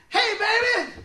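high, loud catcall; yelling at girls. Retold or imitated. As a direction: holler it out the car window. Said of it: "let's go yell at the girls".

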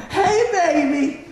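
very slow, high mock greeting; chicken-counter ladies. Counter ladies hail him in line, drawn-out high hello. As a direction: stretch it out high like a doting counter lady.

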